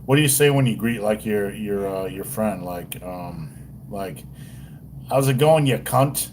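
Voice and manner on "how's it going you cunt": put-on Australian greeting; attempted Australian twang, rising at the end; mock Australian. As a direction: greet a friend in a rough Aussie accent. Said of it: "Like in Australia".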